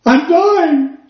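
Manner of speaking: imitating a crying patient; distraught man. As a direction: blurt it through tears. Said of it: "He said".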